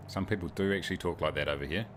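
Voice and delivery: ordinary talk, nothing marked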